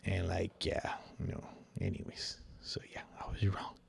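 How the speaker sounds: sheepish unvoiced whisper; whispered aside. Real, not acted. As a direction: confess it under your breath. Muttered aside admitting error.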